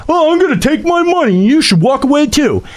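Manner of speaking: high, fast, sneering mock quote; mocking whiny ranter. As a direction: mimic a self-righteous quitter. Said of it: "piping up and going".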